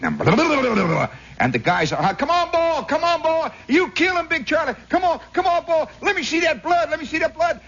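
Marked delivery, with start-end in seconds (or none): 0.2-1.1 high burbling flutter, falling away; imitated flurry of beating wings; tongue-fluttered cockfight noise; wing-flapping noise. Imitates birds retreating in a flutter. 2.1-7.6 very high, strained yelling; imitated frenzied cockfight spectators; crowd hollering. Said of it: "They keep hollering".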